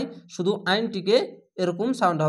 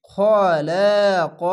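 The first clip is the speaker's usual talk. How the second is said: louder, slow chanted word; Quran recitation; 6 dB louder, much slower than usual. Shown as a demonstration. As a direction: intone it heavily like tajweed. Tajweed recitation, heavy letter. (জোরালো ধীর তিলাওয়াত)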